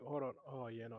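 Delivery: ordinary delivery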